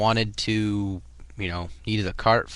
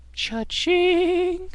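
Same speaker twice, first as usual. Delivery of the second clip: cha-ching. Pretend. Imitating a cash register sound.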